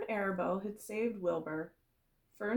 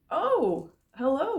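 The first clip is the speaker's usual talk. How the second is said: higher, louder delighted hello; happy smile; 7 semitones higher, 8 dB louder, slower than usual. A narrator's reading. Pig greets his new spider friend with a big smile. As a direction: beam as you say it. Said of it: "Wilbur said with a big smile".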